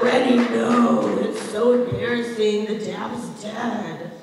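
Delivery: mock whiny teens. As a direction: whine like embarrassed kids. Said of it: "they'll be like".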